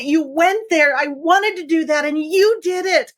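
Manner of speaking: high-pitched, gushing cheer for a friend; excited inner-child cheerleader. Acted. Inner child cheering Heather on, excited and high-pitched. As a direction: cheer them on with big, punched yeses. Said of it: "is to be your freaking cheerleader".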